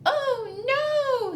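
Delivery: high squeaky ladybug gasp; a little bug crying oh no. Acted. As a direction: squeak it out like a tiny worried bug. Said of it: "says the ladybug".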